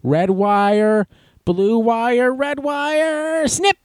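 rising silly sing-song wire count; mock bomb squad. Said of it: "they're going".

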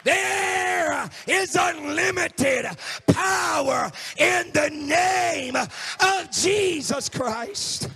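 slow, drawn-out shouting proclamation; preacher's climactic proclamation; preaching at full force. Acted. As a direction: stretch every word and shout.

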